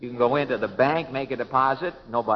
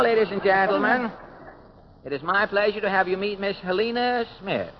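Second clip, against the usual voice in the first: radio announcer; 6 semitones higher than usual. Acted. Host introducing the next guest, announcer voice. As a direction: proclaim it to the studio audience.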